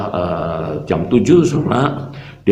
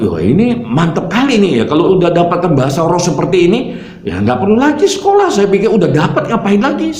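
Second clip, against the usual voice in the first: impressed excitement; 9 semitones higher than usual. Retold or imitated. Retells his impressed reaction to father.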